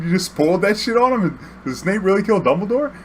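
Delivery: high-pitched incredulous questioning; acting out a stunned friend demanding answers; shocked disbelief. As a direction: pitch climbing in mock disbelief.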